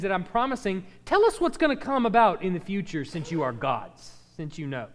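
sarcastic. Acted. God taunting the so-called gods to tell the future, sarcastic. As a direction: taunt them with sarcastic emphasis. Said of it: "He's being sarcastic to the people".

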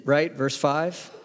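normal talking voice

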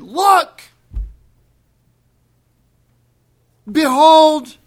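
loud, high cry of Look, then Behold; exclamation. Acted. Frustrated crowd finally bursting out, loud shout. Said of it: "rumination eventually becomes exclamation".

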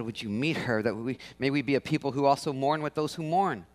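soft, low pastoral prayer. Real, not acted. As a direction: pray softly and reverently. Pastor prays over congregation.

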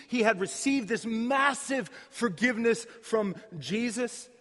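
loud, high preaching; forceful preaching. Acted. Preacher telling Stephen's story, loud and forceful. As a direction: drive the story home to the crowd.

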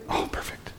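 whispered, pleased aside; hushed delight. Acted. Jesus hearing they have a little bread, hushed delight. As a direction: breathe it out in a whisper.

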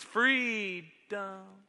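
mild mock yell of triumph; a hint of a shouted victory cry; loud yell. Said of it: "yell out in a loud voice".

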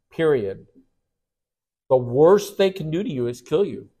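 ordinary talk

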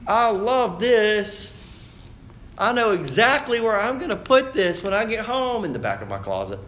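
high, drawn-out fake delight; fakey gift voice. Receiving an unwanted gift, in a high fakey thank-you voice. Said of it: "that really fakey voice that goes".

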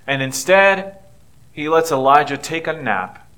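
ordinary delivery

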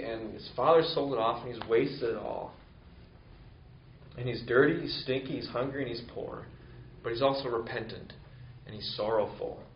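ordinary talk, nothing marked

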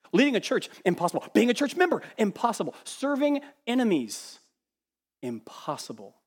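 preaching at full force. Real, not acted. Preacher to his church, building to a hushed "impossible". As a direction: drive each item home, then drop.

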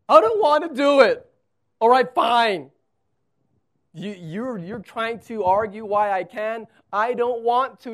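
loud, high sulky refusal; Moses giving up. Acted. Moses finally refusing God outright, loud and sulky. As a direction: refuse like a sulking kid. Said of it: "finally he says".

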